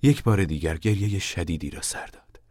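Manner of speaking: ordinary delivery, nothing marked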